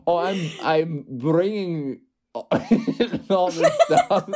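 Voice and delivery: put-on failed Scottish accent, slow with laughter. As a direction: attempt a Scottish accent and crack up. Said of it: "I was trying to do a Scottish accent. I can't".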